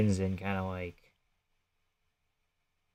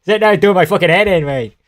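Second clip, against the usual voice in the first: higher, louder, fast put-on British accent; mock British accent; 7 semitones higher, 6 dB louder than usual. Shown as a demonstration. Trying a British accent on his co-host, then a mock British accent. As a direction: try on a British accent, hammed up. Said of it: "That's not the right accent".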